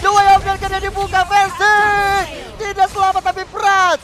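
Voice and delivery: high, fast, rising hype commentary; fighting game announcer. Acted. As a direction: call the teamfight at full hype. Rising live play-by-play on teamfight.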